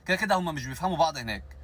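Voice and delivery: ordinary talk, nothing marked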